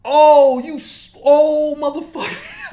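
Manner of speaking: high, loud disbelieving yell; outraged shriek. Real, not acted. Reliving the old man's remark, outraged high yell. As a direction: scream it in outrage.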